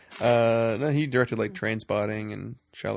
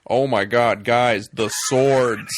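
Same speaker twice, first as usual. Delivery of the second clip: slow, much louder hammed-up American drawl; mock bad American accent; 10 dB louder, slower than usual. Mocking Daniel Craig's movie lines as a bad Harrison Ford. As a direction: ham up a gruff Harrison Ford parody. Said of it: "Harrison Ford making fun of Harrison Ford".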